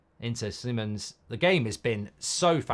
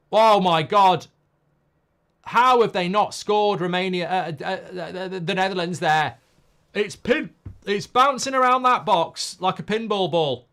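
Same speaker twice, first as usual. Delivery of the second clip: loud, higher, astonished at the near miss; excited match reaction; 4 semitones higher, 7 dB louder than usual. Real, not acted. Watchalong host reacting to a missed chance, loud and excited. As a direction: exclaim in disbelief at the action.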